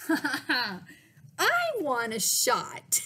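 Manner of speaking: put-on loud, high, drawn-out kid's demand; over-the-top eager child; eager shout. A narrator's reading. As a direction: shout it slowly with glee. Boy eagerly demands a shot.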